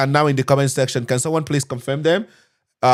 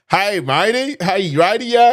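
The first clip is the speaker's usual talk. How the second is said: higher, half-shouted mock Irish accent; bad Irish accent; 6 semitones higher than usual. Warning viewers off interview jokes, botched mock Irish accent. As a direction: ham up a clueless Irish accent for a laugh. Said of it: "I have no idea how to ma-, make an Ireland accent".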